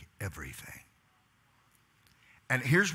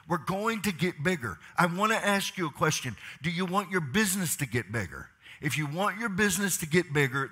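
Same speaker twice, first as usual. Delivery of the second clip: raised, driving pulpit voice; rallying the room to grow; fiery preaching; 6 semitones higher than usual. Real, not acted. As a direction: preach it out to the crowd, building. Preaching at full force.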